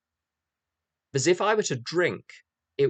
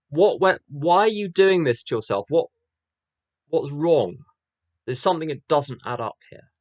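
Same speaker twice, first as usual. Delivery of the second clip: louder, higher questioning policeman; lightly voiced policeman asking why; raised concerned voice; 3 semitones higher, 7 dB louder than usual. Policeman quoted in story.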